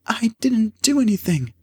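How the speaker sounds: very high, upset voice; acted tearful young woman; tearful protest. A narrator's reading. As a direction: plead innocence on the edge of tears. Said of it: "burst into tears".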